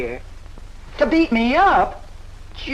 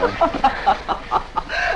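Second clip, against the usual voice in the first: a laugh he takes for crying; breathless laugh. Acted. Kitty laughing as the suitor begs her not to cry, breathy giggle. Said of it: "I'm laughing".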